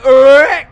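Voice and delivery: loud, high grunt of effort; mock grunt while hitting. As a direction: grunt as you hit the shot hard. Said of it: "I'm like".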